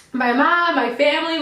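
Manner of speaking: normal talking voice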